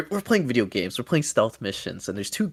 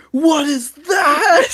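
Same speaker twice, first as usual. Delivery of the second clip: high, loud, drawn-out cry; slow, high-pitched hammy yell; astonished shout; 6 dB louder, much slower than usual. Acted. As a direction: shout it in exaggerated amazement. Acting out astonished crowd member.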